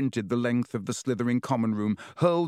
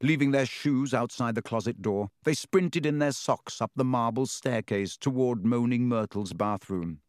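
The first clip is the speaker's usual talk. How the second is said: usual speaking voice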